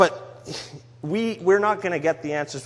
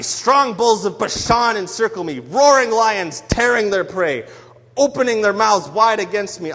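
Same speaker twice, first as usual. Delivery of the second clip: loud, raised, anguished reading; angry lamenting David; 4 semitones higher, 7 dB louder than usual. Acted. Preacher voicing David's angry lament, loud. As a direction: cry out the psalm like a wounded, angry man. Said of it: "And then we go back".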